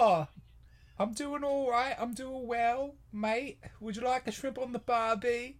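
high Aussie actress voice; lighter, chatty cartoon Australian; mock Australian accent. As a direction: answer in a high, put-on Australian lilt.

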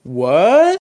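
startled disbelief. Acted. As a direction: blurt it out in disbelief. Acting Ray's startled reaction.

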